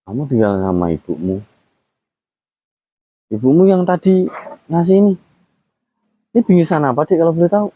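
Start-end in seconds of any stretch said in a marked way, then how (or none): 3.3-7.7 gentle coaxing. Real, not acted. A man gently asking a scared child about a gift.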